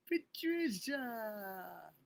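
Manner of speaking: slow, tender, fading name. Real, not acted. Streamer savoring a supporter's name, slow and tender. Softer drawn-out repeat.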